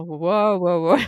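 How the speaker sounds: loud, stunned exclamations of disbelief; stunned wow. Retold or imitated. Mother realising what she agreed to, loud stunned wow. Retelling her panic after saying yes.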